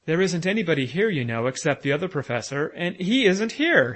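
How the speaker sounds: frantic and rattled, losing track; wildly. A narrator's reading. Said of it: "he added wildly".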